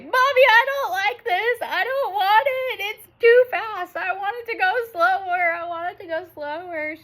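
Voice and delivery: put-on high, whiny little girl voice, close to crying; little girl upset. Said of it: "she's like".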